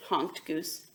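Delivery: ordinary delivery, nothing marked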